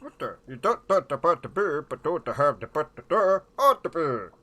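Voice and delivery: loud, high mock-Swedish gibberish; Swedish Chef voice. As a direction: build to punchy, chanted comic Swedish syllables. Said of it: "the Swedish Chef voice".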